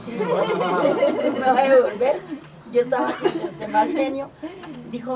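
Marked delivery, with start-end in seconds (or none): 0.3-1.3 loud breathy laugh; burst of laughter. Real, not acted. Laughing while retelling. 1.3-4.3 louder, words through laughter; laughing. Real, not acted. Laughing at her own temper.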